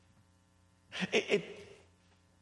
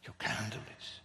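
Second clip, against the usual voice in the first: You can't do this, whispered, much lower and softer; whispered echo; 6 semitones lower, 9 dB quieter than usual. Acted. Preacher echoing his protest to the congregation, whispered. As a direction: let it fall to a hushed whisper. Same words repeated as whisper.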